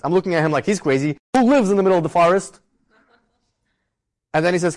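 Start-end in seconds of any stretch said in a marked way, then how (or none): 1.3-2.6 louder, higher mock disbelief; incredulous. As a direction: ask it in retold bafflement, pitched up. Baffled thought at man being crazy.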